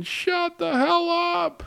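very high, drawn-out mock yell; high-pitched imitation of an annoyed shout; imitating players yelling back. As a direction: holler it slowly from down the hallway. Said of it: "other football players saying".

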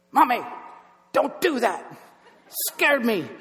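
high, loud mimicking toddler; little girl's voice. Toddler in the car parroting dad's outburst, girl's voice. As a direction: imitate a small girl scolding, in short high bursts. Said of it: "In a girl's voice".

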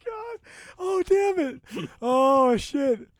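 high, exasperated laughing. Real, not acted. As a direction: laugh helplessly at the joke. Cracking up after the bit.

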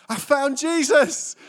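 high, loud, slow and beaming; drawn out with beaming joy; joyful announcement. Retold or imitated. Mum announcing faith to son, slow, high, loud. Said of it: "So she went".

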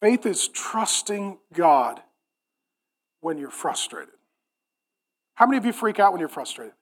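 low, soft, confiding sermon voice; hushed preaching. Real, not acted. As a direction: let long pauses sit, speak very quietly. Preacher drops to hushed, confiding delivery.

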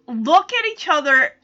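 ordinary talk, nothing marked

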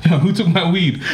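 high, laughing mock accusation; mock angry stepdad. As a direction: play the outraged stepdad. Acting out stepdad scene.

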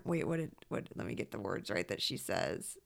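normal talking voice

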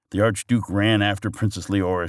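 usual speaking voice

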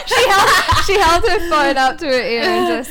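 high, loud laughing speech; laughing hard. Real, not acted. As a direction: barely get it out through laughter. Laughing at friend practising the call.

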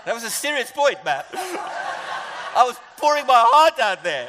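loud laughing through words; laughing after his joke lands. Real, not acted. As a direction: laugh out the protest.

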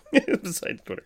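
giggled high-pitched echo; laughing echo. Real, not acted. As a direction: repeat it while cracking up.

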